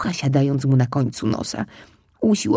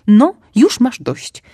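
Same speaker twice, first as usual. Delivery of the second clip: louder, slow decisive mother voice; firm mother; 6 semitones higher, 8 dB louder, slower than usual. Acted. Mother fox stopping the greedy cub, firm. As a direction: put her foot down. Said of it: "powiedziała".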